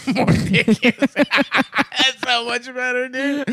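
high, slow words through laughter; cracking up while saying it; laughing. Real, not acted. Laughing at new name.

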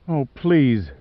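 mild pleading. A narrator's reading. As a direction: beg him gently. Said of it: "she pleaded".

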